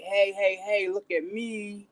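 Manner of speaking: slow, lightly mocking call for attention; mock attention seeker. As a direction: tease someone begging to be noticed. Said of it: "I'm not trying to be on a panel like".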